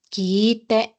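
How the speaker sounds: first "kite" with a long held "i", "kiii-te"; drawn-out "kiiite". Shown as a demonstration. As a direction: stretch the vowel of "kite". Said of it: "para que puedan identificar".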